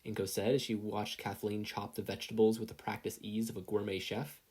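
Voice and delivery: plain reading voice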